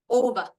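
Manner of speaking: "ova" as OH-va, long O emphasized; stress on first syllable. Shown as a demonstration. Teacher confirms the right option, stress on first syllable of "ova". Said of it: "is the one that has the stress".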